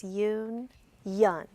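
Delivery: "Yun, yun" as two stretched, separate syllables of union; split into syllables. Shown as a demonstration. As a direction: say "Yun, yun" slowly with a clear break between the halves. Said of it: "split it in the right place".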